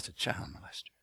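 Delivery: hushed, breathy aside about the guy down there; low, unvoiced prison whisper; retold whisper. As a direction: whisper it like a secret in the cell block. Prisoner points out another inmate.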